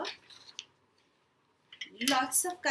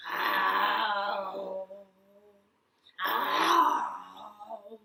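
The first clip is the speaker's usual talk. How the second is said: tiger clearing throat; 4 semitones lower than usual. Acted. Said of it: "tiger cleared his throat and said".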